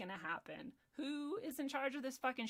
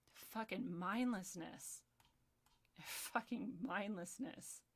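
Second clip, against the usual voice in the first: drawn-out, weary contempt; slow disgust; 5 dB quieter, much slower than usual. Real, not acted. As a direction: let each word sink in slowly. Disgusted verdict after rant.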